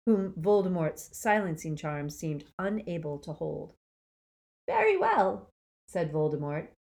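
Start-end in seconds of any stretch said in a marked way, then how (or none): 4.7-5.5 high silky menace. A narrator's reading. Dark lord answering Neville, silky menace. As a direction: silk over danger. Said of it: "the silkiness of his voice".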